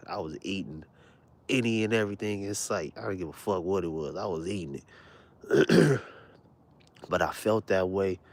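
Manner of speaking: normal talking voice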